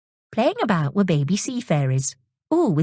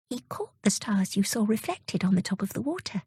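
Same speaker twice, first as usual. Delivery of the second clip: hushed whisper; 4 semitones higher, 7 dB quieter than usual. Acted. Pearl explains the caught stars.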